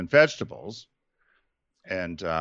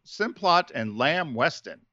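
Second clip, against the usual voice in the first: normal talking voice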